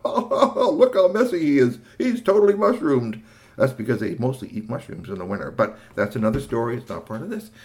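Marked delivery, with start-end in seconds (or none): 0.0-3.2 laughing, higher and louder. Real, not acted. Storyteller laughing at the messy troll, higher. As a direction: giggle through the words.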